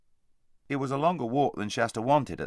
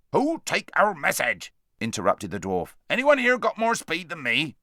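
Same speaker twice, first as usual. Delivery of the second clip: raised, urgent dwarf voice, some lines louder; a practical dwarf rousing the others; bustling dwarf; 6 semitones higher, 5 dB louder than usual. Acted. Dwarf interrupts.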